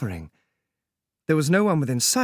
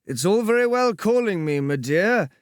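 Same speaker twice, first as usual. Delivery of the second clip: raised, languid dandy tone; sleepy drawly voice; 6 semitones higher than usual. Acted. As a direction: tease her lazily despite the ropes. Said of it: "said the same sleepy, drawly voice".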